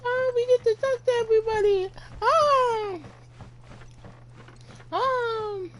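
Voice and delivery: normal talking voice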